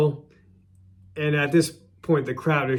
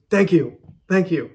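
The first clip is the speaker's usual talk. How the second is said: louder, higher mock thanks to a booing crowd; mock gracious thank you; 4 semitones higher, 6 dB louder than usual. Heel thanking a booing crowd, hammed-up mock gratitude. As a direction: ham up the gracious thanks over the boos. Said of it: "he goes".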